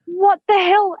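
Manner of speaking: real exasperation at the unfairness; exasperated outburst. Real, not acted. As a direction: throw it up in frustration. Venting frustration.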